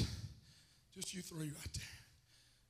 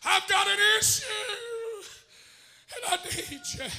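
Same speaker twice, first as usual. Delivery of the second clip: high, slow, drawn-out cry; crying plea falling away; desperate acted plea; 12 semitones higher, 17 dB louder, slower than usual. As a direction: wail the need as the suffering woman. Acts the woman's desperate plea.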